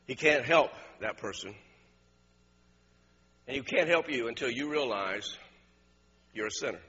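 low, measured, quiet preaching; altar appeal. Real, not acted. Preacher addressing bowed heads about needing a doctor, quiet appeal. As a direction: appeal gently, leaving long pauses.

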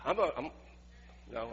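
ordinary talk

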